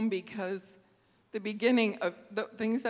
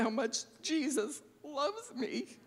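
tearful; 5 semitones higher, 4 dB louder than usual. Real, not acted. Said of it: "I get emotional".

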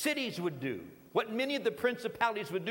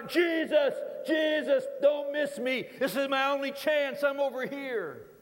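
high, desperate shout for Jesus; louder and louder; 4 semitones higher, 3 dB louder than usual. Acted. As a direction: shout over the crowd as your only chance. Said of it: "gets louder and louder".

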